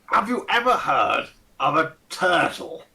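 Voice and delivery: lightly voiced pompous old aristocrat; a hint of a Ronnie Barker toff; pompous old lord. Fat old lord greeting the party, lightly pompous and fussy. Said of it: "with the accent and everything".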